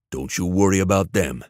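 usual speaking voice

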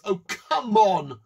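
slow, loud exasperated groan. Real, not acted. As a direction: drag it out in disbelief. Exasperated response.

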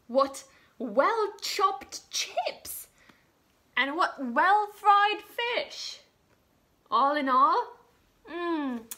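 slow, loud, delighted king voice; pleased king. Acted. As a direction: savour every word like a satisfied king. King praises the meal.

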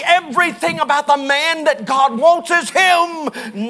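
high, loud, driving preacher cadence; full-force preaching. Acted. Pastor preaches to the congregation, rising to a shout. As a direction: build it to a shouting climax.

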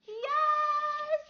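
high squealed yes; real thrilled squeal; elated cheer. Real, not acted. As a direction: celebrate on one high, held note. Celebrating the donation.

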